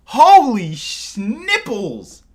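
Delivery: high, loud, drawn-out shock; stunned exclamation. Real, not acted. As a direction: stretch it out in loud disbelief. Shocked by huge donation.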